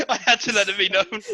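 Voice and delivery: laughing confession. Real, not acted. Laughing at own joke.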